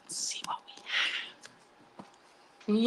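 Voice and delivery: hushed, slow whisper. Real, not acted. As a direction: whisper it slowly in suspense. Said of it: "Think good thoughts".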